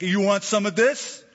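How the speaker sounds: mock confrontational challenge. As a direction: throw down a playful challenge. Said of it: "a few choice words".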